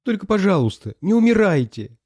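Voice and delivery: put-on high, imploring girl's voice; acted weepy entreaty; tearful plea. A narrator's reading. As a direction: beg gently and high, as if crying. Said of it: "заплакала она".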